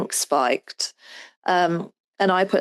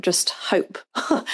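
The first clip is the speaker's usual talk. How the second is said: whispering; 4 dB quieter, slower than usual. Real, not acted. Resigned aside about joining.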